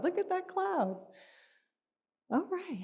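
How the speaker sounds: put-on high, bright little-kid exclaim; excited child voice. Mother voicing her son, high and excited. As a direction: squeal it like an excited little boy.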